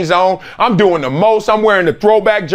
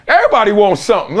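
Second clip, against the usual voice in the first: normal talking voice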